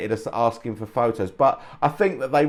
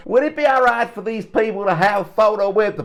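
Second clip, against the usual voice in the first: high, hammed-up Cockney accent; mock Cockney accent; 10 semitones higher, 3 dB louder than usual. Imitating the American bandmate asking his band, in a put-on Cockney accent. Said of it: "He didn't say it in a Cockney accent".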